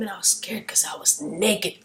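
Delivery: hushed, sheepish whisper; whispered confession. Retold or imitated. Adam confesses his nakedness to God in a whisper. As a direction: whisper it, ashamed.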